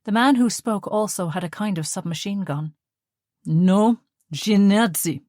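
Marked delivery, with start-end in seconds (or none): 3.5-5.2 put-on Russian accent, loud and insistent on NAZI; Russian officer insisting again, more forcefully. A narrator's reading. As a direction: bark it back at him, louder. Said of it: "this time more forcefully".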